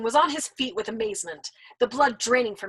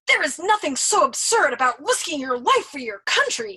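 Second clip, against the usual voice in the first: louder indignant declaration; firm declaration; 5 semitones higher, 6 dB louder than usual. Acted. Nately defending dying for country, loud declaration. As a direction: declare it with conviction. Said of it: "he declared".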